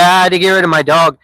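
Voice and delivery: ordinary talk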